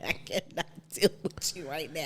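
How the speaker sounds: breathless whispered laugh; giggling too hard to voice it. Real, not acted.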